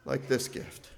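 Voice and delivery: ordinary talk, nothing marked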